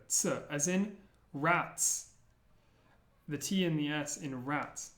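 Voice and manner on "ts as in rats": "Ts" said as a clipped t-s cluster, slowly; ts sound. Shown as a demonstration. Teaching letters, a slow ts sound. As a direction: hit the t and s together in "Ts". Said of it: "It's like a T and an S put together".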